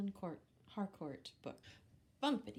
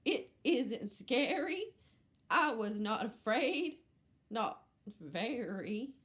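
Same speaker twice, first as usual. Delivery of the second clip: brave goat; 3 semitones higher, 8 dB louder than usual. Acted. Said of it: "said Goat".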